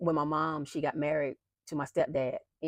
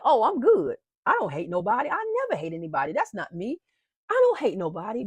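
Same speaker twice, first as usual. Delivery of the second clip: retold protesting denial; 5 semitones higher, 5 dB louder than usual. Retold denial of God's accusation.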